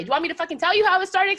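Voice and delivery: ranting at the wrestler, fast and loud; angry rant. Real, not acted. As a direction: tear into him fast. Ranting at Seth Rollins.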